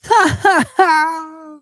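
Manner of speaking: high, loud burst of scornful laughter; mocking laugh. Real, not acted. Reacting to the Arsenal fan's boast with loud mocking laughter. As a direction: laugh in his face.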